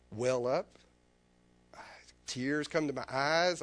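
ordinary delivery

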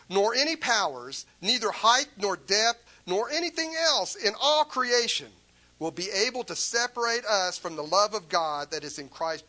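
a fervent sermon climax; preaching at full force. Real, not acted. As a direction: preach it with rising fervour.

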